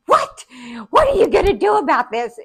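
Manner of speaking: high, loud, fast demand; hammed-up frantic protest; outraged disbelief. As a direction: shriek WHAT, then rush the question. Frantic protest at dad's dismissal.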